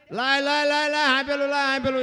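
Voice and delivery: retold yell. Husband yelling for the pestle.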